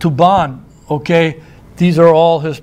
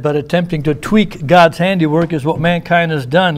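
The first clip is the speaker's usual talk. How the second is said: ordinary talk, nothing marked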